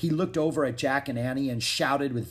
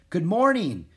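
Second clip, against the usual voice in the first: higher, louder greeting call; 7 semitones higher, 6 dB louder than usual. A narrator's reading. Aristotle calls to Jack and Annie, calling out. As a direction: call a cheery hello across the yard. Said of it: "Aristotle called to Jack and Annie".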